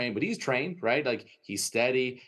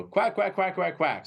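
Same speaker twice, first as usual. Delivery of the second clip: duck call imitation; 8 semitones higher, 3 dB louder than usual. Mimicking duck calls in blind.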